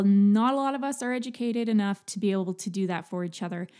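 ordinary delivery

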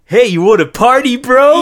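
put-on high, loud party-boy caricature; mock Parisian guy. Podcaster imitating the Parisian contestant, hammed-up and loud. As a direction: hype it up as an imitated Parisian bro. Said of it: "the Parisian guy, I love him".

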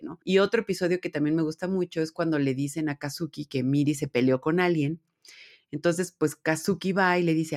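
ordinary delivery

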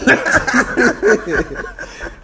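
high burst of laughter; big laugh. Real, not acted. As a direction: laugh out loud at the memory. Retelling the egg whooping.

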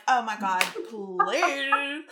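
loud, rising oh my god please; loud exasperation. Retold or imitated. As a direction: scoff loudly at her modesty. Exasperated reply to mom.

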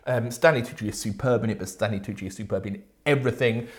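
ordinary talk, nothing marked

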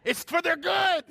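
high, loud laughing words. Real, not acted. Pastor laughing about eye drops for kittens, loud laughing. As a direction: push the words out through a laugh. Laughing while telling cat story.

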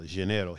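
"Janeiro" with soft zh and tapped R; Brazilian pronunciation. Shown as a demonstration. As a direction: say "Janeiro" as zhuh-NAY-ro, low and soft. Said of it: "That's how we say it in".

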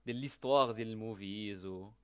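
ordinary talk

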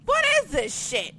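high, loud disbelieving outburst; shocked disbelief. Retold or imitated. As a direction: squeal it in shock. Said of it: "I was just like".

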